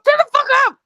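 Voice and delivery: loud, high-pitched outburst; angry shout. Real, not acted. Teased friend silencing the mockers, exasperated shout. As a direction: yell over the teasing to silence it.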